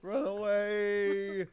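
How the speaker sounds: drawn-out high shout; yelling. Real, not acted. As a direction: yell it slowly as you flee. Fleeing enemies, yelling to group.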